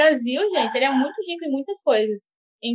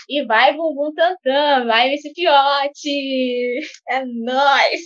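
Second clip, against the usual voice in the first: playful cheering; 3 semitones higher, much slower than usual. Pretend. Cheering for vaccine maker.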